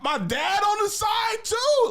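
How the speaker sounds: very high, loud, slow mock shout of disbelief; betrayed disbelief. Seeing dad welcome the boyfriend, high mock shout. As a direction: yelp it like you've been betrayed. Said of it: "And I'm like".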